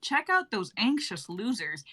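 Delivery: ordinary talk, nothing marked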